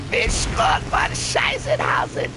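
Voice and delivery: high-pitched mock German telling-off; grandma scolding in German. Grandson imitating grandma chewing him out, high German scolding. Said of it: "chew me out in German".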